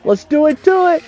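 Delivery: high-pitched, rough cartoon-hero catchphrase; Sonic impression. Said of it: "I can't do a good Sonic impression".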